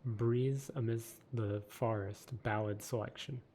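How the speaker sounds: ordinary delivery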